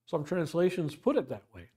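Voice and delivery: ordinary delivery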